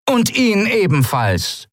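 high slow order; command. A narrator's reading. Captain orders the mate arrested, high and slow. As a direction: order him taken too. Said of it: "befahl der Kapitän".

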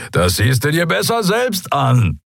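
high-pitched, amused disbelief; incredulously amused. A narrator's reading. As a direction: call it back in amused disbelief. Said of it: "Der Skria klang ungläubig amüsiert".

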